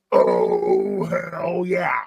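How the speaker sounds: hammed-up wrestler catchphrase; Stone Cold. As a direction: start deep and long, then punch it upward. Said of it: "looking for a reason to do Stone Cold".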